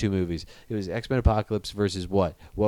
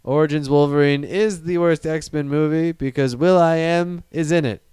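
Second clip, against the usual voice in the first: stately, raised-pitch opening argument; tongue-in-cheek formal oratory, louder than chat; mock debate speech; 4 semitones higher, 3 dB louder, slower than usual. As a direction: deliver it as a grand debater, starting slowly.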